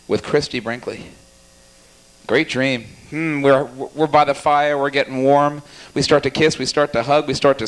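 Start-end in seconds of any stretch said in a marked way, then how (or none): none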